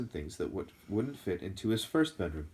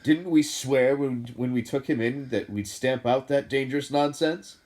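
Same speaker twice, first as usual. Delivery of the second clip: stern insistence; 5 semitones higher, 9 dB louder than usual. A narrator's reading. Vernon insists to Petunia.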